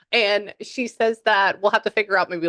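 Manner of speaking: usual speaking voice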